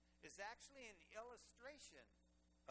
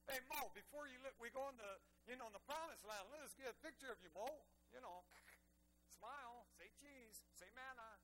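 louder, quick and chummy; playful mock request; 4 semitones higher, 5 dB louder than usual. Preacher jokingly asks Moses for a picture, chummy. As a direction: joke like a tourist grabbing a photo.

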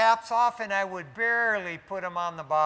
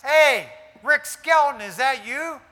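loud, higher call of recognition; calling out across a bar; 7 semitones higher, 9 dB louder than usual. Retold or imitated. Stranger at the bar calls out to the pastor, loud and surprised. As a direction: shout his name like spotting an old friend. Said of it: "I hear this voice".

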